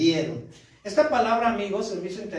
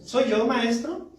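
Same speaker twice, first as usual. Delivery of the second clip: falsely innocent question, slightly drawn out and higher; Judas; 5 semitones higher, slower than usual. Acted. Judas answers the master he betrays, with mild false innocence. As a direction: ask with a touch of feigned innocence. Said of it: "¿Ves ahí su falsedad también?".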